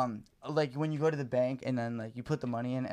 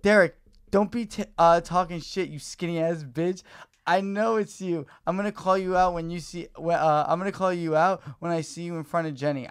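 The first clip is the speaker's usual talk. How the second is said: loud furious rant, pitched higher; angry texter; 4 semitones higher, 8 dB louder than usual. Retold or imitated. Stranger texting about shit in his toilet, loud angry rant. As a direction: tear into him like a disgusted stranger. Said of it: "So they're like".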